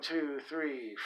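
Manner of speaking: ordinary talk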